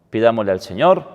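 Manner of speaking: ordinary talk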